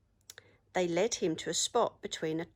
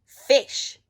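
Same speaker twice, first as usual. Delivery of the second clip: bold loud answer; 5 semitones higher, 14 dB louder than usual. A narrator's reading. Pirate chief tells troll their food, loud.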